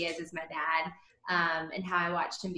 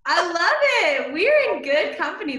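loud, high and thrilled; excited; 9 semitones higher, 9 dB louder, slower than usual. Real, not acted. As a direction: gush with delight. Learns hosts are pastors' kids.

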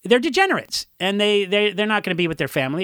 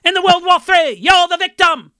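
put-on high, loud English punk accent; 10 semitones higher, 6 dB louder than usual. Quoting the band's song lyrics in an English punk accent. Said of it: "one hundred percent English accent".

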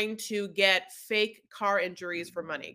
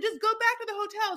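higher pushy order; impatient insisting; 13 semitones higher than usual. Retold or imitated. Husband telling wife to drive to hotel, impatient. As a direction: overrule her, annoyed. Derek overruling producers.

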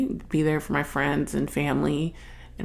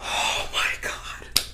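whispered awe; 5 dB quieter, slower than usual. Real, not acted. Overcome recalling movie scene.

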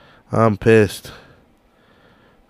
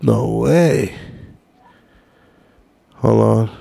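ordinary delivery

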